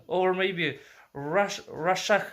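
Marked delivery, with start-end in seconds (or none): none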